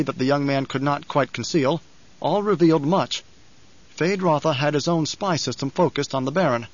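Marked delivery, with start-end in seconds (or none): none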